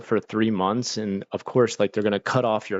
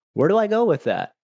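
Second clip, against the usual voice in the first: incredulous; 9 semitones higher, 4 dB louder than usual. Real, not acted. Disbelief at absurd customer.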